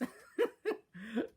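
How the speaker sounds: real high laugh. Real, not acted. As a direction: burst out laughing. Laughing at the scene.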